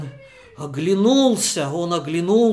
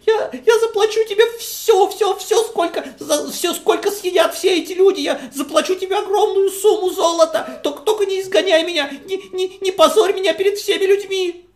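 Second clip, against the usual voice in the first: much higher, fast, near-tears begging; the humiliated guest begging, much higher and near tears; almost crying; 11 semitones higher, 4 dB louder, faster than usual. Acted. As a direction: beg frantically, voice on the verge of tears. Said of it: "Чуть не плакал Баркамца".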